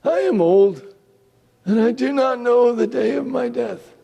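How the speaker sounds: a frail blind father giving orders; old Isaac. Acted. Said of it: "He said".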